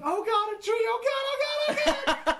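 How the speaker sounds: mock panicked scream, shooting up very high; imitated screaming. Sledder imitating his friend screaming at an oncoming tree, hammed-up panic. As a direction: imitate a friend shrieking in panic, half laughing. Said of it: "Dusty screaming".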